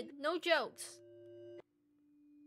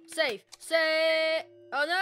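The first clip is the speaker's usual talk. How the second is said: loud panicked shouting; alarmed shout; 2 semitones higher, 7 dB louder, much slower than usual. Real, not acted. Player watching Maria get taken, shouting in panic. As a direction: yell out in alarm. Friend being taken away.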